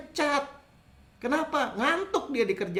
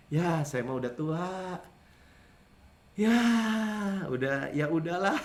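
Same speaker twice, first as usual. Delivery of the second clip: put-on resigned old person; 3 dB quieter, slower than usual. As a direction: give up like someone who feels too old. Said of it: "kan kayak gini". (suara orang tua yang pasrah, pelan)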